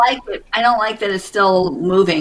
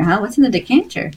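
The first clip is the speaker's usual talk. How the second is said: ordinary talk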